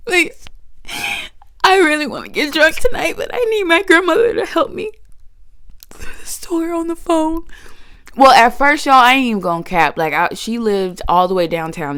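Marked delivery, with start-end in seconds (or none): 0.0-7.5 high, whiny begging to grandma; high crying plea for help; pleading whine. Retold or imitated. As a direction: plead with her like a kid, near tears. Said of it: "And so I was like".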